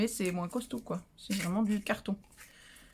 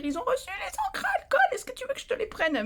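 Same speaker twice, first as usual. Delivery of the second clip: quoted friend voice. Retold or imitated. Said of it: "pour me dire".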